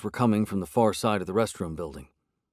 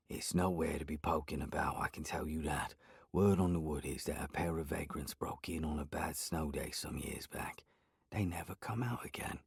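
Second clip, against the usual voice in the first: much softer, hushed warning; voice dropped low; 5 semitones lower, 12 dB quieter than usual. A narrator's reading. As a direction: lower your voice like telling a spooky secret. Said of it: "his voice dropped".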